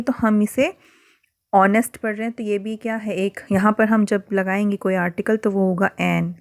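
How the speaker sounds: ordinary talk, nothing marked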